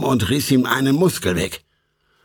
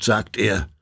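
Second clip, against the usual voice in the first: plain reading voice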